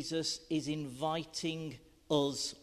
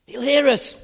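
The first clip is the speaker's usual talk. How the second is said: put-on high, loud urgent child voice; urgent whisper; 10 semitones higher, 13 dB louder than usual. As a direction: warn the gang in a panic. Kids sneaking into orchard.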